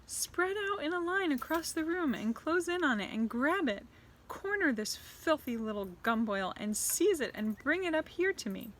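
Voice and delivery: high, cruel witch orders; commanding witch. Acted. Grand High Witch orders witches to corner the boy. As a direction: bark orders at the coven.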